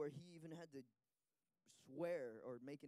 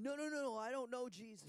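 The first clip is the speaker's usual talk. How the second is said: high, louder repeated no; urgent denial; 7 semitones higher, 4 dB louder than usual. Retold or imitated. Interpreter voices Peter denying Jesus, higher and louder. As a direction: push the accusation away.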